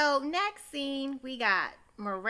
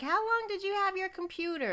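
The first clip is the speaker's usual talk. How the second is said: mock Seema; 9 semitones higher than usual. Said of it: "Seema's like".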